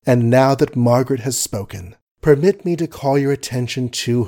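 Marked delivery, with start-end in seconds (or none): none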